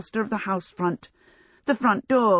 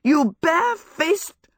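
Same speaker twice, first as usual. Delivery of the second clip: sputtering, higher and slower, lost for words; stuttered outrage; 11 semitones higher, 4 dB louder, slower than usual. A narrator's reading. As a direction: choke on the words in indignation. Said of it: "Sophie stuttered".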